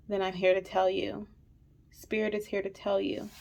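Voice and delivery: fighting not to cry; quiet, tearful words; holding back tears. Real, not acted. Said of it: "I'm trying so hard not to cry".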